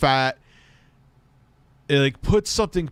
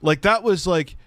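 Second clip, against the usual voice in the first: incredulous rant; 6 semitones higher than usual. Real, not acted. Incredulous rant about the butter.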